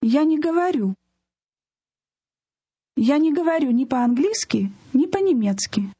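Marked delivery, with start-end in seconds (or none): none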